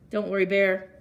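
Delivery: usual speaking voice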